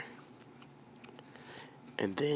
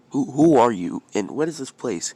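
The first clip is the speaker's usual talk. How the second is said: loud, raised, alarmed question; acted bewildered outburst; startled questioning; 5 semitones higher, 10 dB louder than usual. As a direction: demand answers from a stranger. Startled boy questions strange old man.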